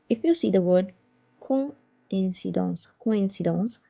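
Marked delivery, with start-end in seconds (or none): none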